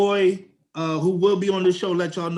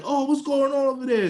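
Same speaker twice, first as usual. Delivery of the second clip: onlookers yelling across; 7 semitones higher than usual. Retold or imitated. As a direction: imitate nosy guys calling from a line. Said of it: "like, yelling over there".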